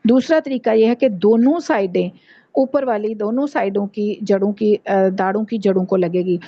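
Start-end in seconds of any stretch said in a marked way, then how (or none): none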